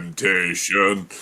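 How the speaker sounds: low, slow and soft; hammed-up lure, sinking low and quiet; mock temptation. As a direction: stretch each syllable teasingly. Repeats the mock temptation tease.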